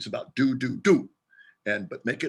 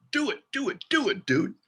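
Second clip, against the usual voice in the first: urging push; 9 semitones higher than usual. Acted. Coaching urge to act.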